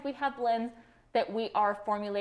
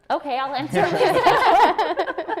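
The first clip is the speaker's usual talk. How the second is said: laughing; 7 semitones higher, 10 dB louder, much slower than usual. Real, not acted. Laughing while taking question.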